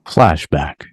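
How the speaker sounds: ordinary talk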